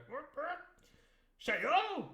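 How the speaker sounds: put-on the squire spluttering at the boy, high and loud; blustering squire. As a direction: splutter like a flustered gentleman. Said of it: "he's always going".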